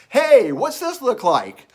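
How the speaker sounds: high, loud, drawn-out call. Retold or imitated. Young man calling to a stranger from a dressing room, calling out. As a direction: imitate a man hollering across a store.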